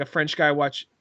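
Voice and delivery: normal talking voice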